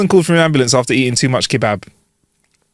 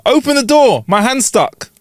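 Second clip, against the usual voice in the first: exasperated caller; 9 semitones higher than usual. Retold or imitated.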